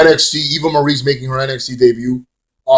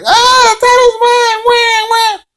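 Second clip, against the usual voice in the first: very high, slow mock crybaby voice; hammed-up whiny gloat; gloating whine; 4 dB louder, slower than usual. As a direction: taunt like a bratty champion. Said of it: "she likes to tweet in character".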